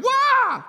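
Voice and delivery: high, loud mock wail; exaggerated kid's fake bawl; fake scream. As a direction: let out a hammed-up, very high pretend cry of pain. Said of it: "I'd scream".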